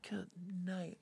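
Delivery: very soft, under the breath; hushed exasperation. Real, not acted. Flustered host to herself after botching the intro, hushed. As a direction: mutter it quietly in exasperation.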